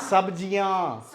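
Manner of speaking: सब्जियाँ slow with held nasal याँ; सब्जियाँ, long nasalized last vowel; nasal ending. Shown as a demonstration. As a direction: draw the end of सब्जियाँ into the nose. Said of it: "वो नाक से लगती है".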